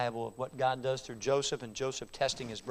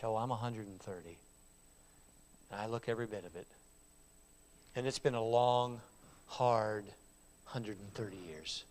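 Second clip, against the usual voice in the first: put-on weary old man; 4 semitones lower, 7 dB quieter than usual. Old Jacob telling Pharaoh his age, tired and fading. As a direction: sink under the years.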